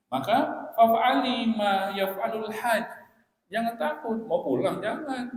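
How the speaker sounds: high, loud, slow proclamation; emphatic preaching. Real, not acted. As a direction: drive the ruling home with force.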